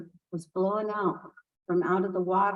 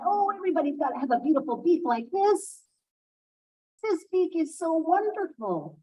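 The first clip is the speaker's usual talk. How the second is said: proud, higher bragging voice; boastful Hawk; 6 semitones higher than usual. Acted. Proud Hawk bragging to everyone about his beak. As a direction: show off the beak, lightly voiced. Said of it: "And he would tell everybody".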